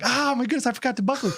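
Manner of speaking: high, alarmed exclamation; panicked. Retold or imitated. Father realizing his son is unbuckled, high alarm. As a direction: blurt it in a fright. Panicked dad in retold story.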